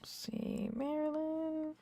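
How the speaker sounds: very soft, high, held notes; soft singsong calling. Pretend. Streamer waiting on Marilyn, soft singsong calling. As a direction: coo it out quietly.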